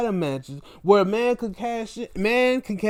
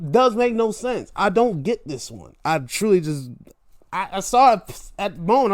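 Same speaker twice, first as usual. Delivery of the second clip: ordinary talk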